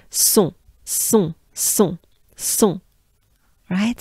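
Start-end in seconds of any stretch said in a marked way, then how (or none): 0.1-2.8 "son" with a clear S and nasal on. Shown as a demonstration. Teacher adding the S to the nasal vowel. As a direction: hit the S, then nasalize "son". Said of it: "With a S".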